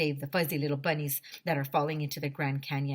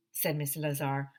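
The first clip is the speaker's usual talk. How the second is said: ordinary delivery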